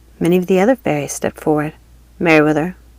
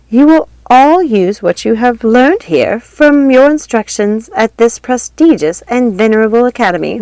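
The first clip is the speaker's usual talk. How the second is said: put-on higher, grand old fairy address; stately fairy godmother; fairy godmother speech; 5 semitones higher, 5 dB louder than usual. A narrator's reading. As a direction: speak to the whole academy with ceremony.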